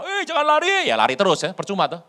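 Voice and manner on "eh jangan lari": high, loud call after a runaway. Retold or imitated. Barak shouting after fleeing Sisera, high and loud. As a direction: shout to stop him running.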